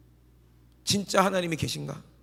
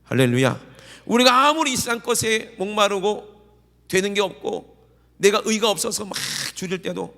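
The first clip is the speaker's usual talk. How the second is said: loud, rising revival preaching; fervent preaching; 4 semitones higher, 5 dB louder than usual. Acted. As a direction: drive the congregation to response. Preaching at full force. (힘차게 외치는 부흥 설교)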